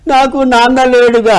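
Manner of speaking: put-on high, slow child voice; little boy. Lonely schoolboy tells the stranger, in a high child voice. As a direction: answer like a small sad boy. Small boy answers the narrator.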